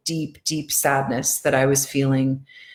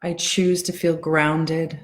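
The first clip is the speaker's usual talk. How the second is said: guided affirmations. Acted. Tapping coach guiding her followers through calming affirmations. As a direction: ease them into calm, one line at a time.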